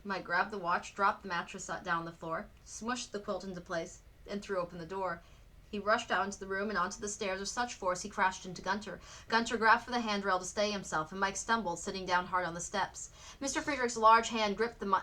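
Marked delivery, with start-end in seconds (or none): none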